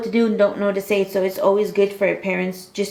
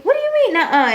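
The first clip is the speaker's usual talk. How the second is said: high, teasing mock surprise; mock disbelief; 13 semitones higher, faster than usual. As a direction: pretend to be shocked by his no. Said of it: "I'm like".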